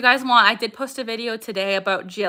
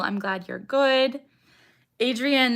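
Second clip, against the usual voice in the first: ordinary talk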